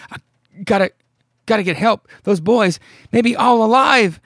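high, loud, halting mock panic; acted urgent cry. Bobby running down the dirt road, mock panicked cry. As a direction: call for help in hammed-up desperation.

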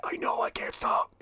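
breathy whispered admission. Retold or imitated. Friend answers in the dark theater, whispering. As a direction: whisper back, helpless.

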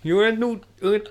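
high, loud mock Geordie DJ; Newcastle accent. Newcastle radio host greeting guests, hammed-up Geordie accent. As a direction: greet the lads in exaggerated Geordie. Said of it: "Tyne Radio here".

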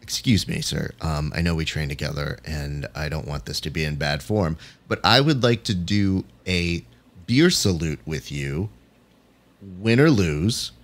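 mock a nervous, stiff politeness; overly polite request. Critic mocking an overly formal, timid request to the opponent. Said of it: "the idea that you have to go".

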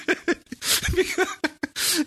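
long high giggle. Real, not acted. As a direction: giggle helplessly. Laughing at edited monologue.

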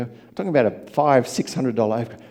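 ordinary delivery

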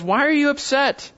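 high, emphatic rebuking question; pointed divine reproof, pitched high; God questioning Jonah. Acted. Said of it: "and God says".

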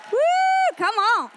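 very high, loud cheer; triumphant whoop. Acted. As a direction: whoop high, then rally the crowd. Celebrates the upgrade with audience.

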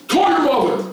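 high, loud command; barked order. Retold or imitated. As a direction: snap the order out. Director orders boy to phone.

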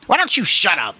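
high, loud exasperated rebuke; irritated command. A narrator's reading. Policeman to the old man, exasperated. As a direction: cut him off loudly.

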